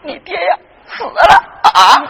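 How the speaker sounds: acted wail, drawn out at the end; weeping mother. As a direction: break the news in a slow, tearful cry. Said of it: "当时就哭了". (母亲拖长哭腔)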